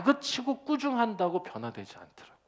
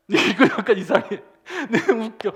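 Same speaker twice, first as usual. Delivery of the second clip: laughing; 4 semitones higher, 10 dB louder than usual. Real, not acted. Preacher laughs at own joke.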